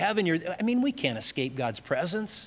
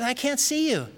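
put-on higher-pitched, mildly pleading child's call; lightly voiced little boy; 9 semitones higher than usual. Little boy to his father down in the dark cellar, lightly voiced higher.

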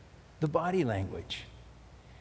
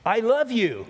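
loud, much higher, spat out in anger; angry I love you; 7 semitones higher, 4 dB louder than usual. Shown as a demonstration. Pastor showing angry tone to congregation, angry snapped. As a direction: snap it like you mean the opposite. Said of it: "if you're angry at somebody and you go".

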